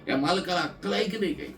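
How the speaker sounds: an annoyed wife fuming about her husband's sense; angry wife. Retold or imitated. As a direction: snap at the absent husband. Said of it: "रागावली".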